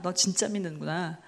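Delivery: hushed acknowledgement, dropping in pitch; God's quiet recognition. Acted. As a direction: say it low and gently subdued, as if to oneself. God acknowledges faith, softer repeat.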